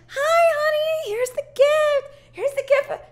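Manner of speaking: very high, sing-out greeting to a husband; mock cheery wife. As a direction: squeak it out, imitating fake cheer. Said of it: "And she's like".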